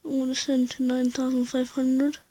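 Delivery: ordinary delivery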